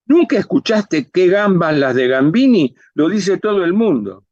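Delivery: diva ofendida, más aguda; diva incrédula. Acted. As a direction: reclama que te reconozcan, con énfasis. (diva indignada y vanidosa)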